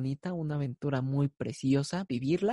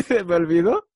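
laughing; 6 semitones higher, 9 dB louder than usual. Real, not acted. Forgets a country, cracks up.

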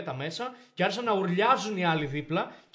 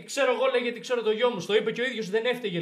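louder, higher angry demand; angry father; 5 semitones higher, 4 dB louder than usual. Retold or imitated. Father bursting in on the principal, angry demand. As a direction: storm in and confront him.

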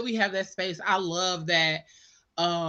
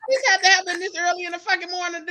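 annoyed; 8 semitones higher, much faster than usual. Retold or imitated. Retold own annoyance.

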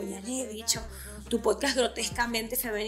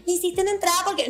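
mocking quote; 7 semitones higher, 3 dB louder, faster than usual. Mocking what critics will say.

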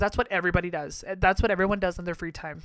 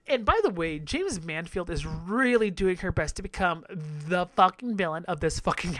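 ordinary talk, nothing marked

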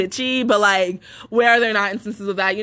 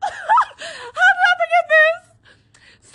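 high, slow laughing cry; laugh-cry in disbelief; much slower than usual. Real, not acted. Disbelief at forgotten memory.

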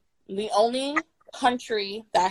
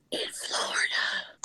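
whisper; 9 dB quieter, slower than usual. Pretend. Joke about quarantining in Florida.

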